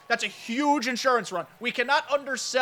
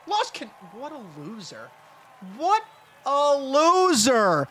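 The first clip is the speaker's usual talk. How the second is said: drawn-out mock jeer, much higher and louder; mocking taunt; 5 semitones higher, 6 dB louder, slower than usual. Real, not acted. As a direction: taunt the loser, stretch "loser" out slowly. Mocking the opponent who failed.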